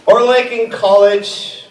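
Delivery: ordinary talk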